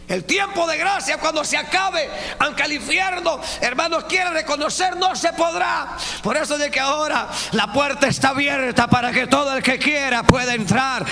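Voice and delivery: high, loud fervent preaching; full-force preaching. Acted. Preacher exhorting the brethren, high and loud. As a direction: drive the point home.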